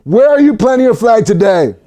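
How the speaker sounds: ordinary talk, nothing marked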